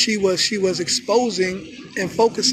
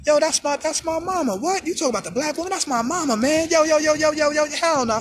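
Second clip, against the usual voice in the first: higher-pitched mock outrage; hammed-up, offended objection; indignant protest; 5 semitones higher than usual. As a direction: jump in to defend your mama. Acts out men defending their mothers.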